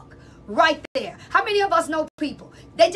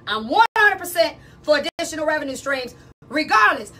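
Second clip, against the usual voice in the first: ordinary delivery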